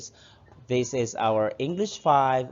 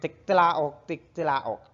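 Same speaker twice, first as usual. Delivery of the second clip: loud higher crowing call; rooster crow; 4 semitones higher, 3 dB louder than usual. Shown as a demonstration. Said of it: "A rooster says".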